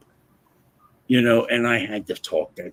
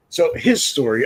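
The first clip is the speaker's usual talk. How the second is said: usual speaking voice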